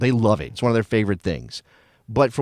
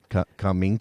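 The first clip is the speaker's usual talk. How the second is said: ordinary delivery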